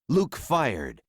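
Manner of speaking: plain reading voice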